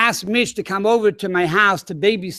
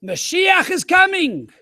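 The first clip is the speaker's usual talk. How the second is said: astonished cry; 9 semitones higher, slower than usual. Retold or imitated. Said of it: "He says".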